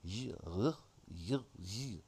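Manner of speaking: low French syllables, rolled out singly; mock French sounds. Trying out pleasant French sounds, mock French. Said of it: "I guess it's kind of French".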